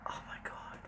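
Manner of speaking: very soft, voiceless whisper; mortified whisper. Real, not acted. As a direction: whisper it to yourself in embarrassment. Embarrassed that she was overheard.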